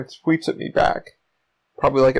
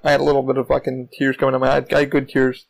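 ordinary talk, nothing marked

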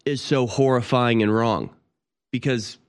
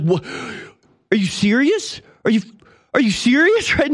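imitating mad brother-in-law; 11 semitones higher than usual. Brother-in-law at the halftime show, high incredulous squeak. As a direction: squeak out outraged disbelief. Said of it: "He's, like, so mad".

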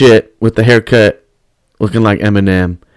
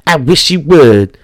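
higher, slow drawn-out threat; slow taunting dare; 6 semitones higher, much slower than usual. Retold or imitated. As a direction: dare him, stretched out slow. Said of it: "And they were like".

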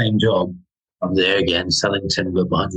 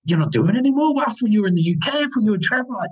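high-pitched mock confusion; astonished; 13 semitones higher, faster than usual. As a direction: imitate a fan who can't believe it. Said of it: "he was astonished".

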